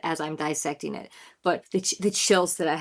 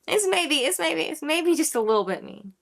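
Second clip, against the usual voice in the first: mock demeaning; 7 semitones higher than usual. Shown as a demonstration. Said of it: "it sounds so demeaning".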